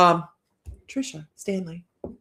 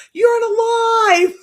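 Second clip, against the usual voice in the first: mock shout; 14 semitones higher, 9 dB louder, slower than usual. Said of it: "And she goes".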